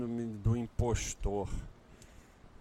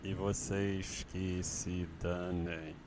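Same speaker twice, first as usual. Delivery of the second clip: slow, low speaking; 2 semitones lower, slower than usual. Real, not acted. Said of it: "vou falar baixo e vou falar devagar".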